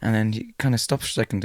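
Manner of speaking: ordinary talk, nothing marked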